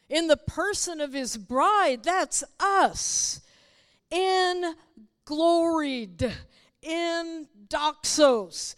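loud, high, impassioned sermon; full-force preaching. Real, not acted. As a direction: proclaim it, stretching the key words slowly.

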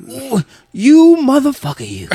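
high, loud teasing accusation with a pointed finger; mock accusing coworker. Maintenance guy teasing the teller, high and loud accusing. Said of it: "staring at me with his finger up, going".

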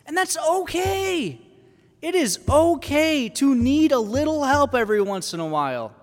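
high-pitched, impassioned insistence; emphatic exclaiming. Real, not acted. Preacher insisting to the congregation we need help, emphatic. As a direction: voice leaping very high, then gradually coming down.

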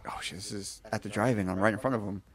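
hushed imitation of awe; starstruck inner voice. Fan realizing he is facing the band, hushed. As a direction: think it softly, lost for words. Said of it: "it just kinda hit me like".

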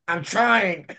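high strained mock reply to the coach; struggling fighter. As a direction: imitate a pinned fighter protesting. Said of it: "He's like".

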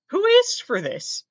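mock indignant. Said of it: "It's like".

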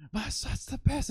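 high mock-proud mom voice; lightly hammed kangaroo mother. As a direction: squeal it like a doting monster mom. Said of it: "she's like".

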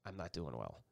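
soft, dropped, choked-up retelling; quiet and choked up, as she said it. Retold or imitated. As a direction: hush it like holding back tears. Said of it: "I could tell she was a little choked up".

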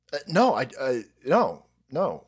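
normal talking voice

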